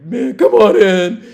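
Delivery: high, loud, slow drawl; grudging welcome. Retold or imitated. Drunk to police at the door, slow loud drawl. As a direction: let them in like a defeated drunk.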